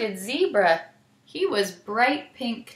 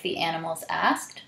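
usual speaking voice

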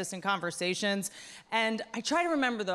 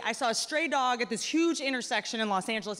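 ordinary talk

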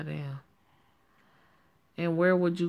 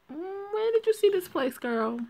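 sassy friend voice. Acted. Sassy friend asks Joanne.

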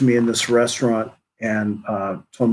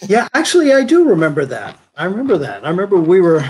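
sudden recall; 6 semitones higher, 4 dB louder than usual. Real, not acted. Sudden recollection of elephant rats.

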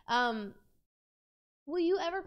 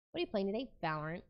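ordinary talk

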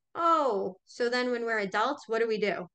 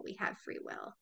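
softer whispered echo; 10 dB quieter than usual. Acted. Restating free will to viewers, whispered. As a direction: let it fall to a whisper.

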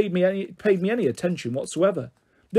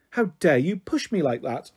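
ordinary delivery, nothing marked

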